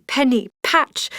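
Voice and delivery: ordinary delivery, nothing marked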